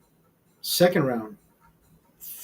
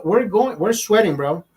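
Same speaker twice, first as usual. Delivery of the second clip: ordinary talk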